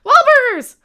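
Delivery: high, loud exclamation; sudden shout. Pretend. Host abruptly announcing a burger chain, shouting. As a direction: belt out the name.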